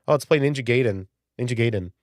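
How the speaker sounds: "Gaiden" with a long A, like gate; childhood pronunciation. Retold or imitated. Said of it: "me and my brother and all of our friends".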